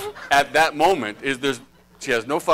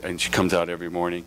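normal talking voice

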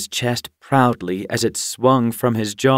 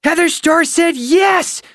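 very high, loud squeal of joy; excited shout; 5 dB louder than usual. A narrator's reading. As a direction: burst out with thrilled news. Young cat bursts in with news.

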